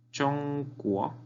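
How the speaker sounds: "Chongguo" with a long high "Chong", rising "guo"; measured tone model of "Chongguo"; first and rising tones. Shown as a demonstration. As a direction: hold "Chong" level and high, sweep "guo" up. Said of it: "Primer tono".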